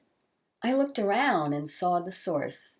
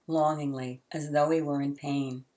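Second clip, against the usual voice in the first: ordinary delivery, nothing marked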